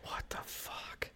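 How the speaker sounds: barely audible, stunned whisper; hushed, slow shock. Real, not acted. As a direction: whisper it slowly in disbelief. Stunned reaction to a death.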